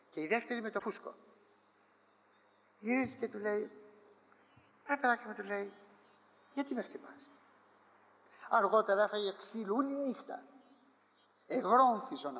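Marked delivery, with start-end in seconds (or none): 4.9-7.3 soft, gentle rebuke; tender complaint to the one who struck him; gentle reproach. Acted. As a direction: softly ask why he strikes.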